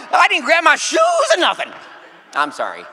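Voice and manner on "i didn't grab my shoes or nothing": high, loud shouted Sweet Brown line through laughter; Sweet Brown impression. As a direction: burst out with it, half laughing. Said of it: "This sweet brown lover".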